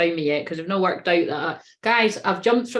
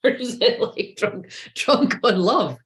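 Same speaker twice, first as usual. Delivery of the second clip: laughing; 2 semitones higher, slower than usual. Real, not acted. Still laughing at drunk idea.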